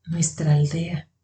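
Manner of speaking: soft, slow, worried murmur; hushed and troubled; low, worried voice. A narrator's reading. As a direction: say it quietly and slowly, with dread sinking in. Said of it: "dijo en voz baja y preocupada".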